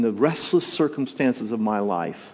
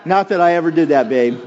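mock denial; 4 semitones higher, 7 dB louder than usual. Joking aside to wife.

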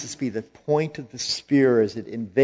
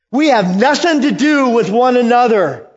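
high, loud repeat of the line; loud demon cry; 10 semitones higher, 8 dB louder than usual. Acted. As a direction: throw the line out in alarm. Louder second take of the quote.

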